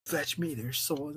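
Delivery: lightly imitating the zombie game's creepy line; a hint of a sinister announcer, slow and soft; eerie game voice. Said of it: "or whatever the fuck they said".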